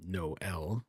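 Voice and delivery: "no L" in two distinct syllables; separated vowels. Shown as a demonstration. Streamer showing how a diaeresis splits vowels, "no L" in two syllables. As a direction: break "no L" before the second vowel. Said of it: "vowel is pronounced separately from the preceding vowel".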